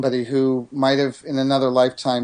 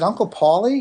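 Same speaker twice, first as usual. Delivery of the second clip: surprised question; 8 semitones higher than usual. Retold or imitated. Father questions son, surprised.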